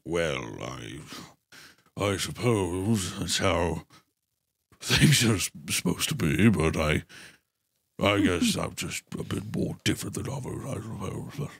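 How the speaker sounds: low, slow mock-despairing mumble; mock Cillian Murphy delivery. Mocking the actor's line delivery, low, slow and brooding. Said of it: "every one of his line deliveries".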